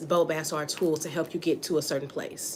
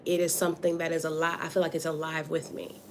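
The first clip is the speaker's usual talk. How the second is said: ordinary delivery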